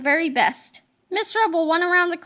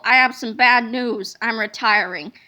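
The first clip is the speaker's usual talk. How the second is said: lightly voiced mean teacher; 6 semitones lower, 4 dB louder than usual. Miss Ribble voiced, low.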